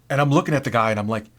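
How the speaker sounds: ordinary delivery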